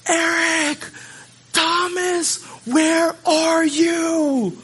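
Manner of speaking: high, long drawn-out calling; mock distant yell. Mom calling her hidden sons in a store, high drawn-out yell. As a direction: call out for lost children, stretching each word. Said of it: "this voice starts yelling".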